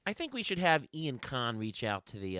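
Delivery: ordinary talk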